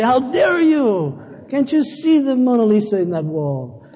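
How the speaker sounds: playing the offended genius painter; mock wounded artist. Pastor mocking his own pride at his wife's criticism, in a high, loud, hammed-up outburst. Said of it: "righteous Michelangelo spirit that wants to rise up and say".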